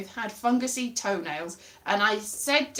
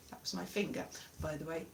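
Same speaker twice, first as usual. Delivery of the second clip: ordinary talk, nothing marked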